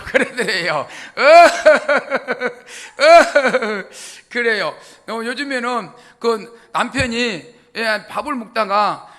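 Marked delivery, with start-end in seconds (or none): 0.9-4.1 big burst of real laughter; laughing hard at the punchline; loud laugh at his own joke. Real, not acted.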